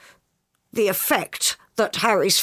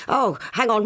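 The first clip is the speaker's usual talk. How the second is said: ordinary talk, nothing marked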